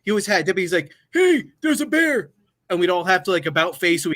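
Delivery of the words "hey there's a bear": high, slow mock sergeant shout; imitating the drill sergeant's warning; drill sergeant call. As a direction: call it out like a sergeant across the room. Drill sergeant voiced in story.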